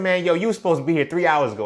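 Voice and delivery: hammed-up fury at a late coworker; dramatic angry. Speaker on the phone scolding the late coworker, a dramatic fast angry rant. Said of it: "I was just being dramatic".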